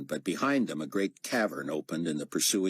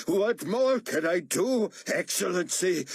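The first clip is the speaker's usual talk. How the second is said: terrified wail; 13 semitones higher, 4 dB louder than usual. A narrator's reading. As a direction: beg in terror. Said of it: "Moon wailed".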